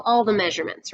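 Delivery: ordinary delivery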